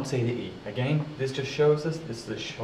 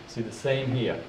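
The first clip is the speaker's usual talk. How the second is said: ordinary talk, nothing marked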